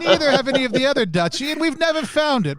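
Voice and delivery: high, loud duchess voice breaking into laughter; high laughing aristocrat; laughing in character. Acted. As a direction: laugh through the noble accent. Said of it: "in that accent".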